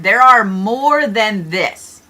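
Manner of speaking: drawn-out, weighty slow delivery; slow emphasis. Real, not acted. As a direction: spell it out slowly so it lands. Repeats after "Let that sink in.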